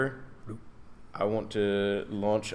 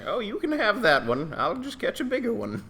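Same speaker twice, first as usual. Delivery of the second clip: haughty higher voice; 12 semitones higher than usual. Acted. [name] in-character boast.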